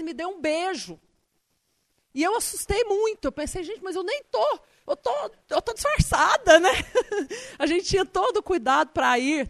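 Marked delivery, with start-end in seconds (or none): 2.1-3.2 sudden high-pitched retelling of a fright; startled. Retold or imitated. Preacher recalls being kissed from behind, startled. 3.6-6.7 high and louder, flustered protest; alarmed thought. Retold or imitated. Thinking to herself after a stranger's kiss, flustered. Her own panicked thought. 6.8-7.5 a quick high burst of laughter. Real, not acted. Preacher laughing at her own disguise story.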